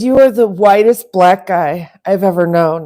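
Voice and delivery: loud teasing quote; retold line to him. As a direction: call him out loudly, teasing. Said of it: "I said".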